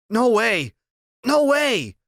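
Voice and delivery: high, loud panicked shout rising on the second No way; frantic boy shouting No way; shouting refusal. Acted. Boy screams refusal at nurses.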